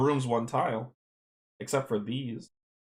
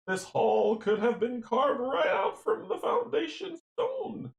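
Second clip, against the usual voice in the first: put-on high, slow old woman voice; Granny voice; 9 semitones higher than usual. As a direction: play the old granny, drawn out. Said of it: "You know you like my voiceover work".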